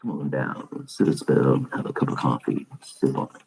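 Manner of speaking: low, soft, folksy welcome; cozy deep host voice. Pretend. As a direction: invite them in like a drowsy radio host. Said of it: "Should I talk like this all the time".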